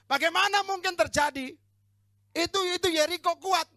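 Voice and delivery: loud, high, incredulous protest; hammed-up doubter's objection; doubting Joshua. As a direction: cry out that it cannot happen. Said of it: "Yosua ngomong gini".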